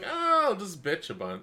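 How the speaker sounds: high, put-on complaining voice; mock whiny self. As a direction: play yourself griping lightly. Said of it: "I'm like".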